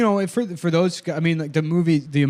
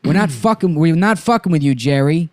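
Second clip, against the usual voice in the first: higher-pitched movie crook impression; Buscemi; 4 semitones higher, 4 dB louder than usual. Kidnapper warning Jerry, agitated Buscemi impression. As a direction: lean on the swearing as an agitated crook. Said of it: "Why is he so fucking hard to do?".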